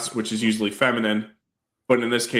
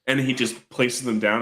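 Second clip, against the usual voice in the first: normal talking voice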